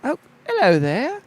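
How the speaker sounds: light welcoming innkeeper. Acted. GM voices innkeeper.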